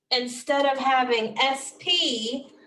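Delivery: normal talking voice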